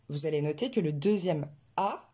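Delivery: ordinary delivery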